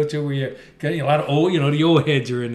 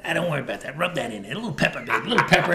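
diner waitress; faster than usual. Retold or imitated. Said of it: "this woman dropped her cigarette ashes".

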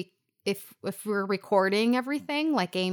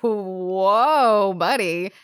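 loud, taken-aback whoa buddy; startled whoa; 9 dB louder, much slower than usual. Retold or imitated. Reacting to Dodd's threat, a loud startled whoa.

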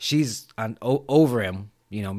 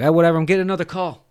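loud, fast, higher dismissal; brusque brush-off; 6 semitones higher, 8 dB louder, much faster than usual. Retold or imitated. Cotton on the phone with an agent, dismissive. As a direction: rush them off the line.

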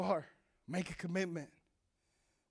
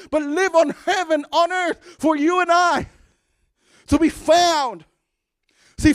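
loud, higher preacher's call; full-force preaching; 4 semitones higher, 6 dB louder than usual. Acted. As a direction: preach it to the congregation.

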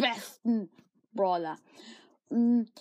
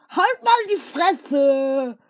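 long furious scream; angry shout; 2 semitones higher, 7 dB louder, much slower than usual. Acted. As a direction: stretch the insult out at full volume. Running gag shout.

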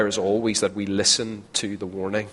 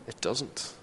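soft echo; 10 dB quieter than usual. Real, not acted. Repeated softly.

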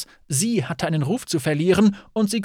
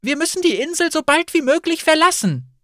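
very high, louder plea; alarmed, raised voice; urgent exclamation; 11 semitones higher, 5 dB louder than usual. A narrator's reading. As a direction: press them to get out at once. Urgent plea to flee the island.

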